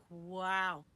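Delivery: loud, drawn-out mock amazement; impressed man's wow. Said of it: "Most men. Most men are like".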